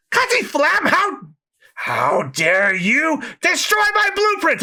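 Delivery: high strained shriek; villain shriek. Acted. Villain shrieking at Franky, high outrage.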